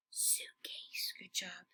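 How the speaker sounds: Suitcase breathed out as a whisper; whispered echo. Shown as a demonstration. Teacher echoing with the class, whispered. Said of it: "teacher whispers echo to class".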